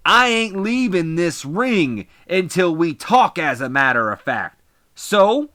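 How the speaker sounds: loud, high heel-wrestler shouting on the mic; angry wrestler promo. Acted. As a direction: bark it into the mic like a furious heel.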